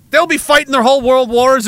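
ordinary talk, nothing marked

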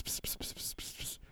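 hissing pss pss; whispered pss sounds. Retold or imitated. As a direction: hiss like secret whispering. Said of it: "it sounds like".

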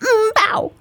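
high half-bark bow; wrong mew. Acted. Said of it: "all I can say is".

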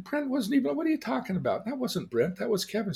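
high-pitched, insistent protest; acted incredulous arguing. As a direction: act out disputing your wife's memory. Acted argument with wife.

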